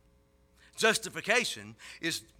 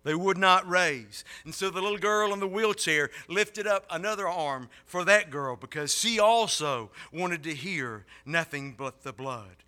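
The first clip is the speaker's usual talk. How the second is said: ordinary talk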